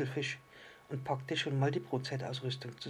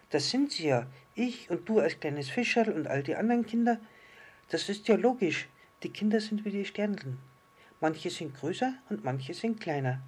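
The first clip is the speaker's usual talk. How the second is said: high, emphatic little-star voice; delighted little star; 6 semitones higher, 4 dB louder than usual. Acted. Little star sees children as stars, high and excited. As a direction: explain it excitedly as the small star.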